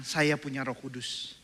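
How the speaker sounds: slow confession; leading a slow call and response; slow declaration. Shown as a demonstration. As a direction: say it slowly for the congregation to repeat.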